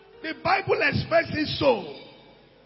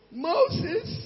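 mock name-call, pitched up; retold calling out; 7 semitones higher than usual. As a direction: call out a name, imitating the wife. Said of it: "Then she shouted my name".

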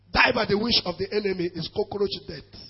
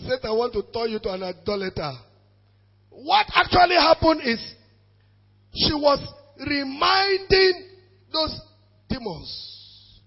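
ordinary talk

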